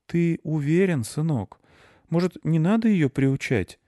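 high-pitched, concerned mum voice; doubtful mother. A narrator's reading. Mother asking her son about the dog, doubtful. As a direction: question your child gently but doubtfully.

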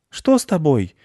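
high-pitched anxious question; concerned little boy; worried boy. A narrator's reading. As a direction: ask it up high, rushing to help. Boy rushes to fallen hare.

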